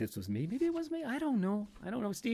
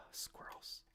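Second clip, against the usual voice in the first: sighed out under the breath; resigned whisper; 23 dB quieter than usual. Real, not acted. Grumbling to self about hated squirrels, whispered sigh.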